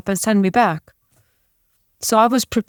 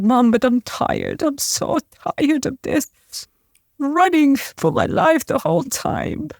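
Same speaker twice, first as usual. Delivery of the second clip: weary retold plea; 7 semitones higher than usual. Exhausted plea to her mother.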